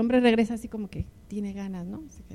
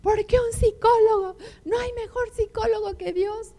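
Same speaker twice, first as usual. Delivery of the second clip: upset husband; 9 semitones higher than usual. Retold or imitated. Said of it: "se salió ofuscado".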